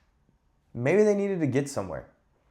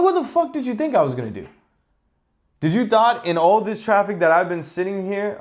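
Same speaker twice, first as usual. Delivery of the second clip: high, fast, loud angry yell; furious yell; 8 semitones higher, 3 dB louder than usual. Retold or imitated. Yelling out the window at the other driver, furious. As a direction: blast it out the window at him. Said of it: "I'm like".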